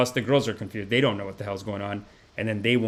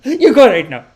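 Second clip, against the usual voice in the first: much higher, louder mock ranting aunt; imitating the mad aunt shouting, with a laugh; furious aunt; 12 semitones higher, 10 dB louder than usual. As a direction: screech at him to leave. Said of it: "She's like".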